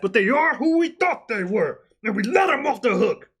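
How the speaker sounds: shouted imitation of a coach losing it; hammed-up press conference outburst; mock angry rant. Imitating Dennis Green rant.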